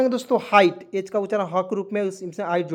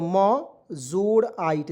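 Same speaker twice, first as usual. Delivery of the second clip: slow spelling out; much slower than usual. Shown as a demonstration. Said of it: "M से म लिखेंगे और इसमें आइट जोड़ेंगे".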